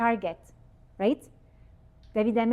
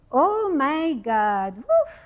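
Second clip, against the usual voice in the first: shocked; 3 semitones higher, 4 dB louder, much slower than usual. Pretend. Watching her life video.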